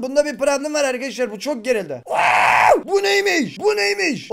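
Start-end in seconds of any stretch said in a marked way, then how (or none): 2.1-2.8 low sudden startled yelp; real startled yelp; startled gasp. Real, not acted. Startled by huge spider mob. 2.8-4.3 high, astonished what-is-this; real amazement; amazed. Real, not acted. Amazed at new mob.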